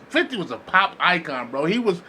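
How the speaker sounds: normal talking voice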